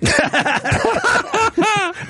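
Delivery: high burst of laughter. Real, not acted. Joke about everyone crying.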